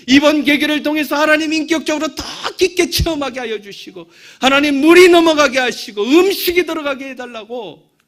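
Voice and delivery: high, loud fervent prayer; retold tearful prayer. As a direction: cry out to God for the sick man. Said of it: "펑펑 울면서 제가 기도했어요".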